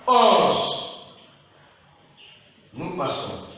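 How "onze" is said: "onze" louder and higher with a slow fall; repeat after me. Shown as a demonstration. Teacher asks pupils to repeat after her, firm. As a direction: give "onze" firmly for the class to echo. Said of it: "Les après moi".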